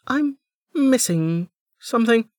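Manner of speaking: very high bubbly voice; a cheerful girl babbling apologies; chirpy young woman. A narrator's reading. Niriri apologising to Velika, bubbly and high.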